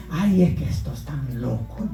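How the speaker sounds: a hint of a doubting visitor; mocking outsider. Newcomer scoffs at shouting worshippers, lightly mocking. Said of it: "dicen por ahí".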